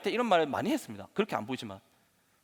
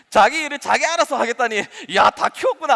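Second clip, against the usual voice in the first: louder, higher mock pride; proud parent; 5 semitones higher, 6 dB louder than usual. Parent seeing the child raised, mock proud exclamation. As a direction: exclaim like a parent pleased with the job done. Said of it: "하면서 막 뿌듯하시던가요?".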